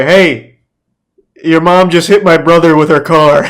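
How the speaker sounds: a hammed-up accusing shout; imitating angry caller. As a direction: play the scammer barging in with bad news. Scam caller's alarming opening line.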